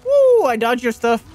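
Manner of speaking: high, loud gloating cheer; triumphant whoop. Real, not acted. Player taunting the enemy after a dodge, high loud gloat. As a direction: crow over dodging the attack.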